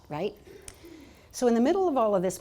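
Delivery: usual speaking voice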